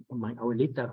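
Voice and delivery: ordinary delivery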